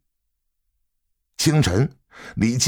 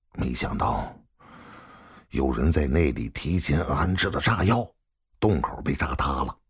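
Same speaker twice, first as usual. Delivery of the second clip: low, subdued, grave report; serious; 6 semitones lower, 4 dB quieter than usual. A narrator's reading. Son reporting an explosion to his father, serious. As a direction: break grave news quietly, in a lower voice. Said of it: "李云帆严肃地说".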